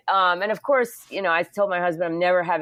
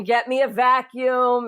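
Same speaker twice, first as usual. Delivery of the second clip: higher, slow retold yell; mock yell; 6 semitones higher, 3 dB louder, slower than usual. Surgeon yells for a vacuum, higher and slow. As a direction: bark it like a panicking surgeon. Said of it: "and he's yelling".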